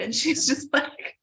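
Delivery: normal talking voice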